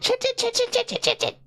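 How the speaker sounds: high, rapid stream of swearing; rapid-fire cursing. Shown as a demonstration. Gamer cursing at video games, high rapid-fire repetition. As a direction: rattle it off in a high-pitched burst. Demonstrates her cursing while gaming.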